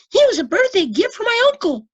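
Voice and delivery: put-on high, loud boyish voice; boy explaining. A narrator's reading. Boy telling the librarian about his pet. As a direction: answer proudly. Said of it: "Lois replied".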